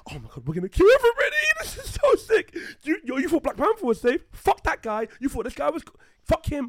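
loud, very high, hammed-up glee, rushed at first; mock giddy excitement. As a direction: squeal it like over-hyped filmmakers plotting carnage. Voices filmmakers gleefully killing characters.

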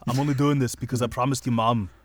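put-on Irish-tinged Austrian action-hero accent; Arnold Schwarzenegger. Podcaster plays Terminator saying Thomas's line, mock Austrian accent. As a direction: do a mock Austrian tough guy gone Irish. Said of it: "imagine if Arnold Schwarzenegger was Irish".

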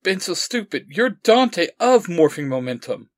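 piecing it together in disbelief; in shock. A narrator's reading. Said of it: "still slightly in shock".

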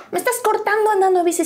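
high-pitched incredulous question; mock indignant disbelief. Boyfriend being dumped on a bike, high disbelief. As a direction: throw it out high, aghast. Retold breakup quarrel.

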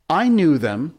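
high, loud, needling brag; teasing boast. A narrator's reading. John bragging to annoy the other boys, teasing. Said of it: "John said, to annoy the others".

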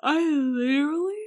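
talking through a yawn; words stretched by a yawn. Real, not acted. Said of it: "sorry, I just yawned".

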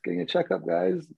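brushing off fans at the clinic with a mock bashful denial. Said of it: "I'm like".

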